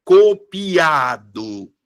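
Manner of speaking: "Copiado" drawn out very slowly, leaning on a long A; "Copiado" in slow motion, weight on A; stress on A. Shown as a demonstration. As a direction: stretch the A of "Copiado" as one long strong vowel. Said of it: "a sílaba tônica tá aqui".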